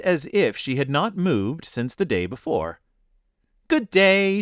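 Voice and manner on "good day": put-on high, cheery village-girl greeting; cheerful. A narrator's reading. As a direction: greet them brightly, pitched high. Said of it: "she said in the same cheerful voice".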